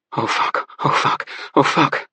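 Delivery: breathy panicked whisper rising. Acted. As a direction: gasp it out in horror. Husband panics over dead wife.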